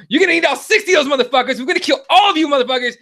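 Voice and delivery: high, rushed mock rant; losing his mind. Friend threatens the frat boy's brothers, re-enacted frenzy. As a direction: fire off threats in a re-enacted outburst. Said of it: "I lost my mind and I go".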